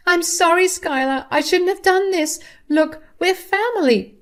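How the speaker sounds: high, loud desperate pleading; begging mother-in-law. Acted. Mother-in-law being arrested begs her daughter-in-law, high and pleading. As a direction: beg for mercy in a high strained voice.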